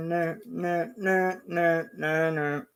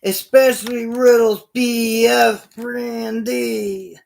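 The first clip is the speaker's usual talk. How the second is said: slow, louder mocking taunt; 3 semitones higher, 6 dB louder, slower than usual. Elias taunting the wrestlers, lightly voiced mock taunt. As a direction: tease the whole ring. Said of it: "he goes".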